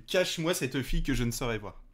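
ordinary talk, nothing marked

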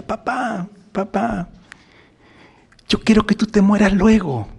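put-on high, sweet child voice pleading with papa; be the innocent little daughter; little girl. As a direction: Saint Thérèse as a child asking her father, in a high sweet voice. Little girl addresses her father.

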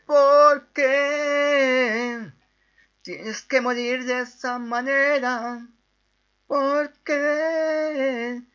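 high, drawn-out melodramatic wail; mock tragic lament. Gamer mourning the dead worm, hammed-up high wail. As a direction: wail each word like an overblown tragedy.

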